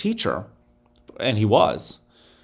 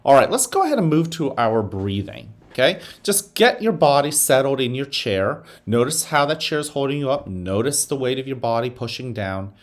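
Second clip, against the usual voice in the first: gentle meditation voice, unhurried pauses; guided breathing meditation; 4 semitones lower than usual. Acted. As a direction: talk the class through calm breathing.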